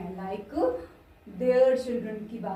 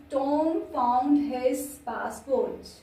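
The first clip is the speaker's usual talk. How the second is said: slow dictation; much slower than usual. Shown as a demonstration. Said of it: "slow dictation of example".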